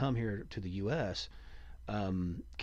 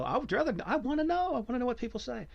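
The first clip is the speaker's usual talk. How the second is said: eager pleading; 14 semitones higher, faster than usual. Real, not acted. Begging to hear the word.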